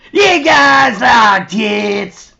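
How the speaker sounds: a loud, slow mock proposition; skanky woman's voice. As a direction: ham up the crude toothless woman. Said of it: "That's how she sounded".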